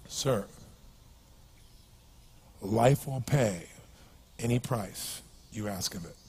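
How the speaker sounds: low, soft and very slow; weighty words, spaced out with long pauses; solemn pronouncement. Retold or imitated. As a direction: deliver a grave lesson quietly. Mock-solemn line delivered to the beggar.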